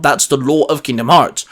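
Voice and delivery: ordinary talk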